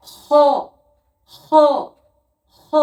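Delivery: হ from the back of the throat; careful letter drill; Arabic letter demo. Shown as a demonstration. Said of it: "হ উচ্চারণ হয়".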